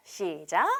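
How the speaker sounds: ordinary talk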